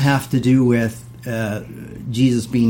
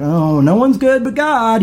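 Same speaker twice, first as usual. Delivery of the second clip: smug false modesty; 8 semitones higher, slower than usual. Retold or imitated. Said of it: "wink, I'm perfect".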